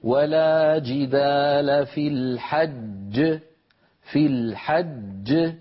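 slow recitation with a bounced final ج in الحج; الحج: doubled ج, the second one bounced at the stop; stopping on الحج. Shown as a demonstration. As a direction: recite slowly, qalqala on the ج of الحج. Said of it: "هكذا".

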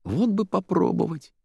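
high, wistful wish; longing, dreamy lilt. A narrator's reading. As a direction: wish it aloud, lifted and airy. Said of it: "мечтательно спросила Заира".

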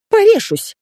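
very high-pitched shriek; squeal. A narrator's reading. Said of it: "взвизгнула Светка". (пронзительный высокий визг)

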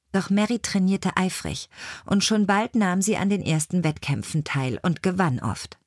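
usual speaking voice

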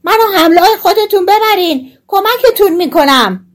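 high, loud little character voice; loud calling. A narrator's reading. Tiny hero begs the thieves to take him, calling loudly. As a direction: call up shrilly from the ground. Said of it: "دوباره با صدای بلند".

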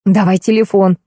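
plain reading voice

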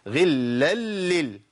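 very slow غلا لل, each lam held; merged lams. Shown as a demonstration. Teacher counts merged letters aloud, held lams. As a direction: drag out the chain of lams in غلا لل. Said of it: "وعليها تنوين".

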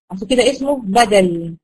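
ordinary delivery

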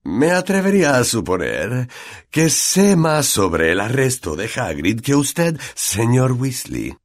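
slow, smug, measured put-down; pleased with oneself, drawing out the words; self-satisfied tone. A narrator's reading. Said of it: "empleando un tono de satisfacción".

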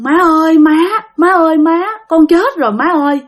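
mếu máo kể lể, cao giọng và to hơn; gọi mẹ như đang khóc nức nở, nhưng chỉ là nhại lại. Retold or imitated. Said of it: "mà khóc quá trời khóc luôn". (hồn người con về khóc với mẹ, giọng mếu cao)

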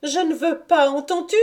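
high, tearful-edged insistence; insistent repeat. A narrator's reading. Lucienne repeats her refusal to the departing officer, high insistence. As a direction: insist desperately in a high-pitched voice. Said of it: "Elle répéta".

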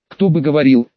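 plain reading voice

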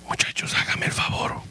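hushed whispered aside; quiet whispered request. Real, not acted. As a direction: whisper it off to the side. Preacher whispers aside to musicians.

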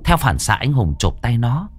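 tense, lively storytelling voice; horror story narration. A narrator's reading. Narrator telling a ghost story to listeners, tense storytelling. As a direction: pull listeners into the haunted boarding house.

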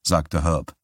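plain reading voice

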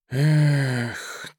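long, weary exhaled sigh; heavy sigh. Acted. Merchant regrets the dead captain, sighing heavily. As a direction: let the breath out heavily. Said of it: "тяжело вздохнул Усольщиков".

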